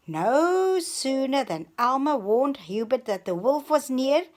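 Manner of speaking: plain reading voice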